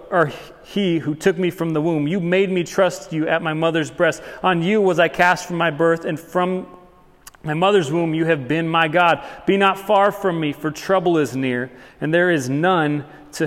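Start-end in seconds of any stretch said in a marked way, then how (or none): none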